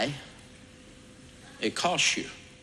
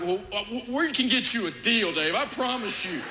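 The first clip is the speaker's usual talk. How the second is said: flustered salesman; 10 semitones higher than usual. Retold or imitated. Flustered salesman offers deal.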